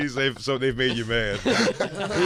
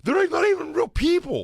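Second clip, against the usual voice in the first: mock indignation; 14 semitones higher than usual. Acting out man ranting about fans.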